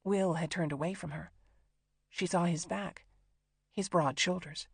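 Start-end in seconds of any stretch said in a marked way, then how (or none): none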